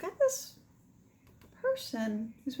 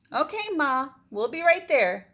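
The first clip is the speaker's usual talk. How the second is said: calling back; 5 semitones higher, 7 dB louder than usual. Acted. Maggie answers mother across river.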